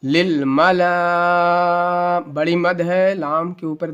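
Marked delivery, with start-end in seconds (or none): 0.0-2.2 للملا chanted with a hugely stretched last vowel; long madd. Shown as a demonstration. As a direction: hold the final "laa" of للملا very long and level. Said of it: "پانچ چھ الف کے برابر اس کو کھینچ کر کے پڑھیں گے".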